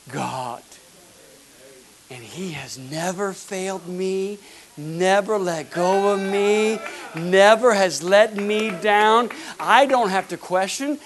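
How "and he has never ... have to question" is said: slow, rising from plain to loud and higher; preacher swelling with conviction; slow building fervor. Real, not acted. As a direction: build it word by word.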